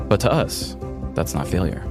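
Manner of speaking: normal talking voice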